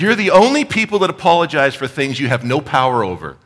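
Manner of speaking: high, loud amused jab; driver teasing. Retold or imitated. Driver teasing the preacher about apologizing, high and loud. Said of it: "He said".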